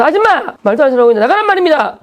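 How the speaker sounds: high yell at the teacher; schoolboy yelling at the teacher in class; shouted demand. Retold or imitated. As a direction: jump up and yell her out. He stands up and yells.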